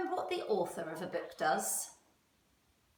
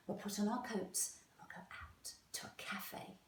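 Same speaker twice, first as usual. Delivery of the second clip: soft whisper of a clever idea; daddy whispers his plan to go out to a cafe; secret plan; 11 dB quieter than usual. Acted. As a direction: whisper it like a conspiratorial dad. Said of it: "Sophie's daddy said".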